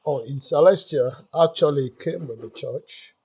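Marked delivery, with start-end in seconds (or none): none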